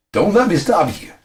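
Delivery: high, loud comic cry; calling out. Acted.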